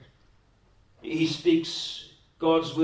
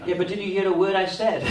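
laughing retort; 5 semitones higher, 5 dB louder, faster than usual. Retold or imitated.

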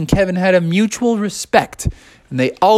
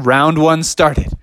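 ordinary delivery, nothing marked

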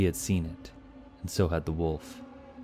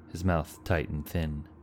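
ordinary delivery

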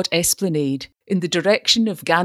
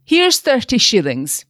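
generous; 7 semitones higher, 5 dB louder than usual. A narrator's reading. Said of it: "he said generously".

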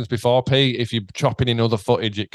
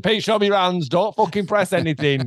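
urgent, higher-pitched order; re-enacted alarm, voice raised in pitch; panicked; 6 semitones higher than usual. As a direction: plead for hands off in mock panic. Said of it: "a fucking moment of panic".

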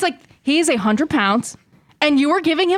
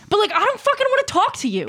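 higher-pitched irritated protest; getting heated; 8 semitones higher than usual. Acted. Dog owner refusing to chat with runner, heated. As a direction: tell off an intruding stranger. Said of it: "now I'm heated".

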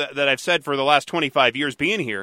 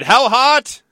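mock setup; 10 semitones higher, 6 dB louder than usual. Comic setup question.

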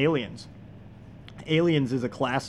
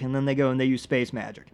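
ordinary talk

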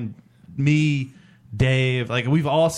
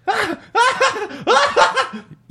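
imitated high, loud breathless cackle; Roman; 5 dB louder than usual. Friend cracking up at the wings place, imitated loud cackle. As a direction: mimic a friend's high-pitched laughing fit. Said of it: "I hear Roman do the laugh".

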